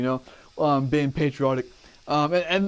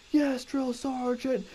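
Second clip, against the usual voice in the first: barked military recruit reply; 10 semitones higher, 5 dB quieter, slower than usual. Retold or imitated. Recruit answering drill sergeant.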